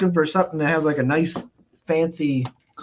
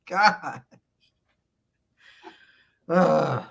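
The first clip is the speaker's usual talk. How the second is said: ordinary talk, nothing marked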